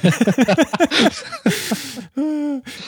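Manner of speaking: a burst of high-pitched laughter. Real, not acted. Amused at the Tempel remark, a high laugh.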